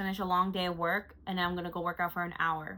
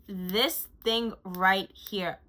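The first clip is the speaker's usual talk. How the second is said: very slow, weighty presentation; slow emphasis; much slower than usual. Real, not acted. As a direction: drag each word out. Slow emphatic product showcase.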